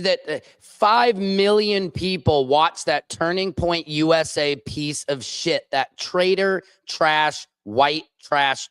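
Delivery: normal talking voice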